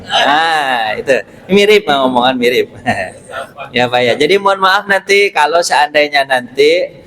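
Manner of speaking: ordinary delivery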